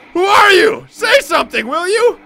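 high, frightened screaming mother; panicked shout. Acted. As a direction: shriek it at the stranger in terror.

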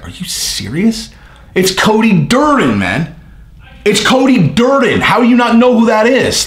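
high, slow, insistent, rising to a shout on Cody Durden; can't believe they don't know him; exasperated disbelief. Retold or imitated. Retelling an exasperated argument.

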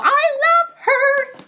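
high, slow adoring coo; high drawn-out gush. Real, not acted. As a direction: stretch it out with affection. Gushing over friend's picture.

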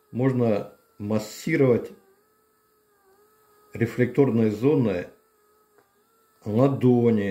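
usual speaking voice

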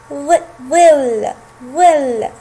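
teacher blending ظل slowly; sounding out. Shown as a demonstration. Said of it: "حرف الضاد جاء في أول الكلمة".

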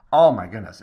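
loud burst of joy; excited exclamation. Real, not acted. Pastor recalling the visitors' theology talk, excited burst. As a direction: blurt it out delighted.

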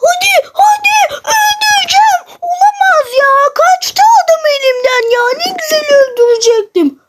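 high-pitched yelling as the enemy flees; panicked high shout mid-fight; frantic gamer shout. Real, not acted. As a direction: scream in frustration, way up high.

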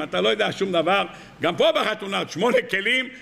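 ordinary talk, nothing marked

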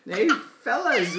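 lightly voiced story character calling out; Wimp's line. A narrator's reading. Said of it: "Wimp said".

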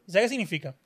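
ordinary talk, nothing marked

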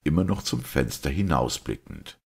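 ordinary delivery, nothing marked

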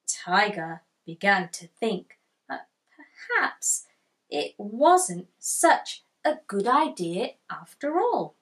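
plain reading voice

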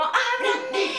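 "¡ábranme!" high and drawn out slowly; urgent plea. Shown as a demonstration. Teacher shows the feeling of the move, a high drawn-out cry. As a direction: cry "¡ábranme!" like banging on a door. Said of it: "Esa, esa sensación".

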